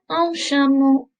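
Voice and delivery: "chameau" slow, soft "sh" start, "o" ending; teacher models "Un chameau" slowly with soft sh; soft sh sound. Shown as a demonstration. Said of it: "Put that together".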